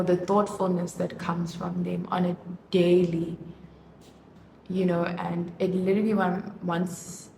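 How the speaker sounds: ordinary delivery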